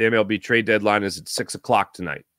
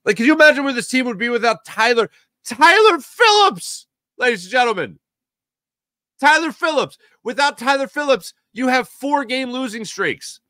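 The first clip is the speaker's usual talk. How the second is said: much higher, sarcastic ring-announcer voice; sarcastic showman, pitched way up; mock announcer hype; 13 semitones higher than usual. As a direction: hype Tyler Phillips like a boxing announcer, tongue in cheek. Mock hype about one pitcher.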